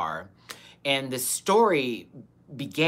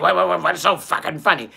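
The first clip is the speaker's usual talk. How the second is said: stuttering mock outrage, higher and louder; imitated mad guy; 4 semitones higher, 5 dB louder than usual. Retelling the guy challenging the laughing sisters, sputtering mock anger. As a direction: sputter like an offended man, hammed up. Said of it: "'cause they got mad".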